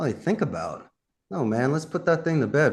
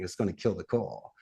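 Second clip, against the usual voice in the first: ordinary talk, nothing marked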